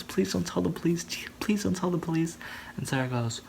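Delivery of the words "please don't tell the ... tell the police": high, urgent imitated begging; mock pleading. Rebecca begging Sarah not to report it, mock pleading. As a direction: act out begging in a higher voice.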